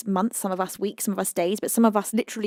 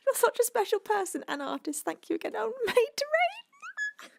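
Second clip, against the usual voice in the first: choked up; 13 semitones higher, 4 dB quieter than usual. Real, not acted. Streamer thanks Made to Raid through tears. Overwhelmed thanking her supporter.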